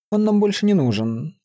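high-pitched brush-off; dismissive. A narrator's reading. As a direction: wave the matter away. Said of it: "отмахнулся хранитель".

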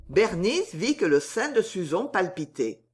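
ordinary delivery

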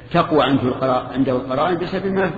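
ordinary delivery

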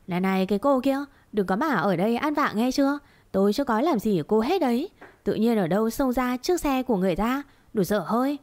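ordinary delivery, nothing marked

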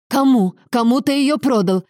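alarmed, angry questioning; sharp interruption. A narrator's reading. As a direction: cut in and demand an answer. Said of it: "перебил его Лоренцо".

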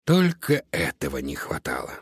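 a touch of sighing dismay; upset sigh. A narrator's reading. Cat grumbles about sausages the mice stole, upset. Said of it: "огорчённо вздохнул".

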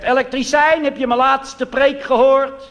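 high, loud, slow preacher's voice; preacher showing off his sermon; pompous minister. Retold or imitated. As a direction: ham up the self-important dominee. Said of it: "Hij zegt".

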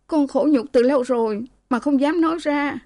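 high-pitched plaintive daughter; acted distressed complaint; tearful confession. Beaten daughter tearfully confesses her misery.

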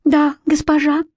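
small high voice, bashful; bashful little fairy. A narrator's reading. Ashamed fairy answering the head of the council, bashful squeak. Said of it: "Трикс стыдливо кивнула".

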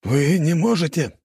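high, slow, dismayed voice; alarmed protest. A narrator's reading. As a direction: protest in alarm, drawing the words out. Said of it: "побледнел Мылин".

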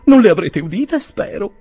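high, flustered falsetto dying away; bashful. A narrator's reading. Spinster aunt caught confessing to Tupman, ashamed. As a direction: start startled, end in a hush. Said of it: "disse tutta vergognosa".

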